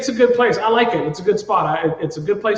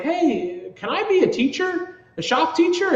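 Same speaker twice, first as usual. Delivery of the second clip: put-on pitched way up, a kid asking mom for something; high, hopeful plea to his mom; eager hopeful question; 10 semitones higher than usual. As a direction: ask it like an eager kid calling home. Calling mom with a hopeful request.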